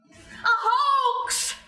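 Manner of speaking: high, drawn-out cry of outrage; indignant outcry. Acted. Reindeer denouncing the Santa radar story, indignant high cry. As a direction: cry it out high, stretching the last word.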